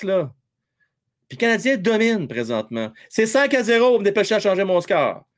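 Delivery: normal talking voice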